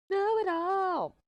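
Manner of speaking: high, slow mocking sing-song; mocking know-it-all voice. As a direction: stretch it out like a taunt. Mocking people who argue.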